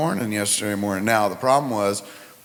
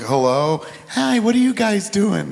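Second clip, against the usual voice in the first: put-on kid on the phone; 9 semitones higher, 4 dB louder than usual.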